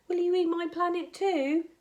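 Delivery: put-on worried boy. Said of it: "Charlie worried".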